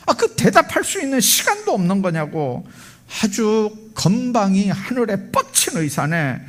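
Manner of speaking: high-pitched retold indignant griping; angry complaint. Father complaining to the nurse about the surgeon, angry complaint. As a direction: rail about someone's arrogance. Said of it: "의사를 향해서 막 욕을".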